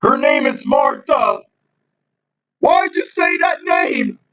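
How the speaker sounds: high, slow, yelled superhero line; dramatic movie shout. Retold or imitated. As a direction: belt it out like an overwrought movie hero.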